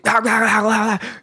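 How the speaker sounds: high, rapid imitated barking; garbled drill instructor barking noise; mock barking. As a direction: gabble wordless sergeant barks.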